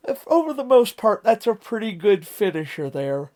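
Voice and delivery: talking through a yawn. Real, not acted. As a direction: yawn while you talk. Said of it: "I'm yawning a little bit".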